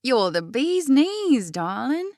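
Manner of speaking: high, slow, hammed-up New York drawl; exaggerated New York accent. A narrator's reading. Marina flattering her friend Jenna, exaggerated New York accent. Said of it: "in an exaggerated New York accent".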